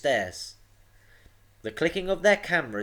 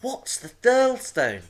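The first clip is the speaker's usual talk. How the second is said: high, loud, slow question; high-pitched character voice of a puzzled boy; 12 semitones higher, 6 dB louder, slower than usual. A narrator's reading. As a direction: ask it loudly, pitched way up. Boy asks about the dreaded island.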